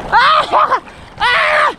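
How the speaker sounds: high, long cries; real high-pitched screams; excited yelling. Real, not acted. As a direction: holler over the catch. Yelling while handling the crab.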